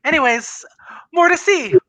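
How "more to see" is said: high, loud cheerful cry; excited. Acted. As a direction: sweep them off gleefully. Spirit whisking Scrooge away.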